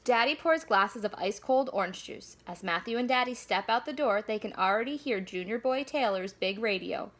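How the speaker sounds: usual speaking voice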